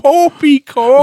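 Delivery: high, slow pompous "Poppycock"; sputtering fake British colonel; bad British accent. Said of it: "does the worst British accent on purpose".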